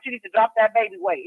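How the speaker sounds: normal talking voice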